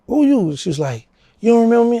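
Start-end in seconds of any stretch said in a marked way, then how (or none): 0.1-0.5 surprised question. Retold or imitated. Retold meeting the girl. 1.4-2.0 put-on girl's teasing question. Girl voiced in story.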